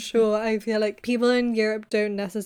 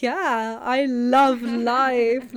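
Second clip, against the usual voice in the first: loud, drawn-out gushing with a laugh; mock enthusiasm; 2 semitones higher, 5 dB louder, much slower than usual. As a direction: stretch it out slowly and loudly, hamming up the joy. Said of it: "take on this enthusiasm".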